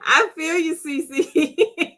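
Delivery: high-pitched, laughing agreement. Real, not acted. Host telling Cece she feels her, laughing hard. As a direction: say it while cracking up.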